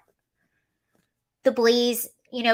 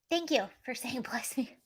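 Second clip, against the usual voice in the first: soft laugh mid-sentence; quiet, amused thanks; 10 dB quieter than usual. Real, not acted.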